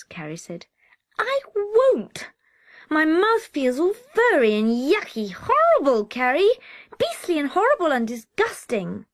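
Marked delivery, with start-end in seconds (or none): 1.2-9.1 high, loud tantrum wail; a small boy howling in refusal; much higher and louder whining tantrum; wailing child. Acted. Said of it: "stopped him mid-wail".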